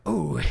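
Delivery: a sharp, loud pained ooh. Retold or imitated. Retelling the moment his knee blew out in the dumpster, a loud pained ooh. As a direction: wince out loud as the knee goes.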